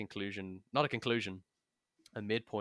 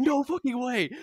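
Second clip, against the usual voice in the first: much higher and louder, yelling in disbelief; stunned yell; 10 dB louder than usual. Retold or imitated. Player yelling at the DM over the reveal, stunned yell. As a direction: blurt it out like you can't believe it. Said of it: "He was like".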